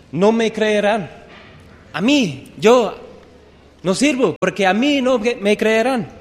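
mock desperate protest; protesting Moses. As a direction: Moses begging to be let off. Preacher voicing Moses' protest.

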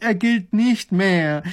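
slow, high, exasperated groan. A narrator's reading. Grand Duke cancelling the order, groaning. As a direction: drag out each word loudly. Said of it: "stöhnt der kleine Großherzog".

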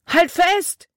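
high, loud urgent call. A narrator's reading. Boy pulling the branch shouts to the girl, urgent. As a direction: yell at her to keep holding. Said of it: "rief er".

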